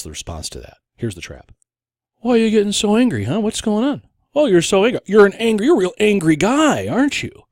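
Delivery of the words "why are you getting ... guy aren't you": high, loud goading; hammed-up baiting in a raised, high voice; mock taunting. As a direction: needle them like a playground bully. Acts opponent baiting the angry victim.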